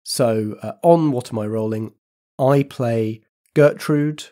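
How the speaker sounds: upbeat podcast host read; compere announcement. Acted. Compere [name] addresses the listeners, bright announcer read. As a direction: present the programme to listeners.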